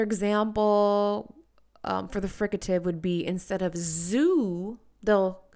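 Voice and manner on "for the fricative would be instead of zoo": usual speaking voice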